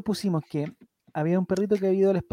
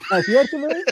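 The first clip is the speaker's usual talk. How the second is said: laughing, higher and louder repeat; laughing disbelief; 7 semitones higher, 5 dB louder than usual. Real, not acted. Incredulous friend laughing at a podcast joke.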